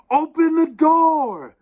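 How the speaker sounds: high, loud, drawn-out plea; desperate, long-stretched yell; desperate cry. A narrator's reading. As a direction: shout desperately to be let in. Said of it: "we cried in desperation".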